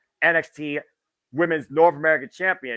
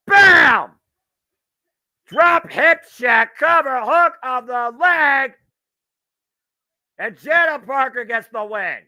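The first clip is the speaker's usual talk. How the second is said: high, loud, slow finishing call; wrestling commentator; 10 semitones higher, 8 dB louder, slower than usual. Real, not acted. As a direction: yell out the winning pin. Match finish called live.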